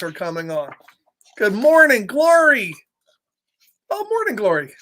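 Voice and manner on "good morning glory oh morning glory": usual speaking voice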